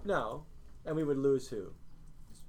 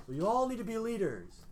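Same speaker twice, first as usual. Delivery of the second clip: mocking pushy parents; 7 semitones higher than usual. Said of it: "what do all our parents and teachers and youth pastors, people push you guys towards".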